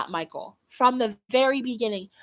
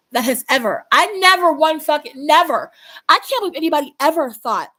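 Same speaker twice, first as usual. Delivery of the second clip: emphatic outburst; 4 semitones higher, 9 dB louder than usual. Real, not acted. Furious denial about Peter.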